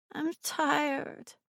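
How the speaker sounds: put-on high, thick sleepy child voice. A narrator's reading. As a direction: mumble it half asleep. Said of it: "Her voice was thick".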